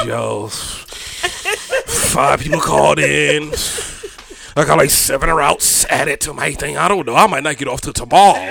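a hint of a worn-out mail carrier complaining; weary mail carrier. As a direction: imitate an overworked friend, unhurried and low. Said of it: "He was like".

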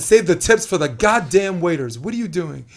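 loud yell at the TV. Retold or imitated. Yelling at Julia on TV to stop tipping, loud. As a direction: shout it like at the game on TV. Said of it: "I said".

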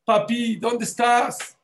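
put-on high, slow plaintive child's call; a lost girl weeping for her parents; mild mock sob of a child calling mamá; imitating crying child. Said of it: "venían llorando y decía".

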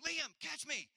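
high, loud comic yell mid-flight; mock yell. Dad yelling to his son while flying through the air, high and loud. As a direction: yell it like you're falling on him. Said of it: "flying through the air yelling".

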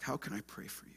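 hushed, caring whisper; gentle whisper. Pretend. Pastor models a quiet question for a friend, whispered. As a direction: breathe the question softly, almost no voice. Suggesting what to ask someone.

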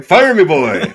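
high, loud and laughing, a cartoon hero's cry; hammed-up hero shout. Friends laughing as she mimics the Tick's cannon cry, loud and high. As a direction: laugh it out like a goofy hero yelling to be launched. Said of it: "he's like".

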